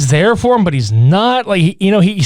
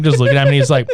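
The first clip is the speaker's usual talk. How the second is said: ordinary delivery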